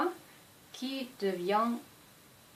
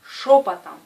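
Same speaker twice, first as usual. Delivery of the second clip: "Шёпотом" called out much louder; loud demo word; 13 dB louder than usual. Shown as a demonstration. Teacher calls out the example word, much louder. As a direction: call out "Шёпотом" loudly. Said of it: "Voici d'autres exemples".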